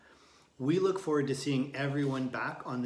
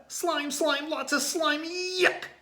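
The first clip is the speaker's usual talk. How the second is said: high, loud disgusted yell; 6 dB louder, slower than usual. A narrator's reading. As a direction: yell it out, grossed out. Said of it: "yelled Tate".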